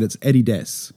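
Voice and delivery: "Adidas" as "ADDY-dess"; New Zealand pronunciation. Shown as a demonstration. Guest corrects Americans, "Adidas" as ADDY-dess. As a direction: stress the first syllable of "Adidas": ADDY-dess. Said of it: "which is not right".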